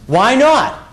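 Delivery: normal talking voice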